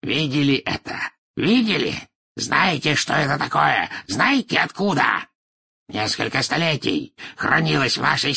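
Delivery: high, excited, haughty character voice; proud pure-blood patriarch, worked up; boastful old wizard. Acted. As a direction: show off the family heirloom proudly.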